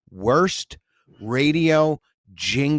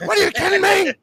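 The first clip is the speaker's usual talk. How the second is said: high incredulous yelp; mock radio host; 3 dB louder, faster than usual. Imitating a sports host, high outraged yelp. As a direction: shriek it in disbelief.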